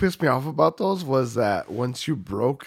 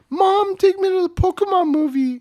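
put-on much higher, louder pleading child; whiny kid voice; 7 dB louder than usual. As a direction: beg like a little kid. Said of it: "'cause I was like".

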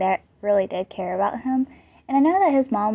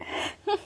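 short laugh; 7 dB quieter than usual. Real, not acted. Laughs after her own squeal.

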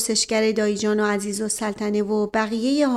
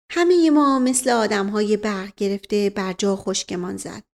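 ordinary delivery

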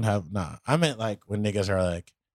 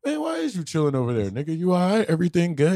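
higher, louder fussing; mock concern; 8 semitones higher, 4 dB louder than usual. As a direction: fuss over him like a nosy friend. Imitating friends fussing.

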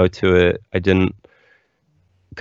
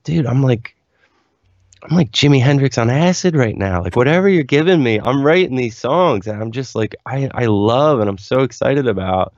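higher-pitched, excited rush of enthusiasm; excited retelling; 6 semitones higher than usual. Retold or imitated. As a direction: act out gushing to the doctor. Acts out elated report to doctor.

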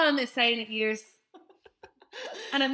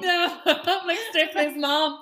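loud, slow words through laughter; laughing; 7 semitones higher, 6 dB louder, slower than usual. Real, not acted. Friend cracking up about her own face. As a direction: laugh it out as you say it.

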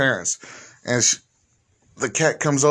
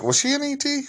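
high mock voice. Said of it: "She's like".

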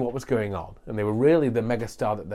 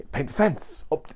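put-on Japanese accent; 4 semitones higher than usual. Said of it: "dangerously close to a possibly racist Japanese accent".

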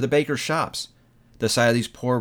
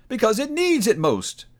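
echoing ethereal energy being; 8 semitones higher than usual. Acted. Spirit answers Scrooge.

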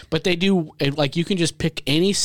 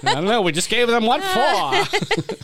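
laughing soldier; 8 semitones higher than usual. Retold or imitated. Mock soldier reply, laughing.

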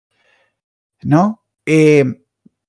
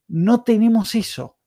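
ordinary delivery

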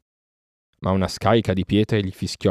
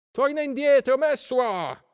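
very high mob yell; shouting crowd; slower than usual. A narrator's reading. Crowd ordering Mesua back, shouting. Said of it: "gridò la folla".

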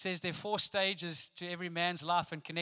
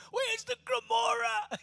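husband in the advert; 3 dB louder, slower than usual. Retold or imitated. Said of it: "he's like".

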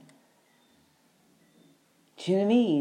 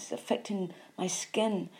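ordinary talk, nothing marked

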